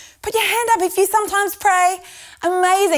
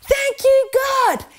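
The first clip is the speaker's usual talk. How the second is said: yelled out; 8 semitones higher, much slower than usual. Shown as a demonstration. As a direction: belt it out with everyone. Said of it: "Once more".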